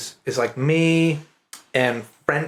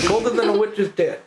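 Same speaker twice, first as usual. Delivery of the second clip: mock Maine saying; 7 semitones higher than usual.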